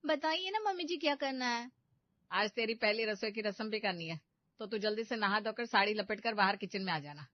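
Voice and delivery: ordinary talk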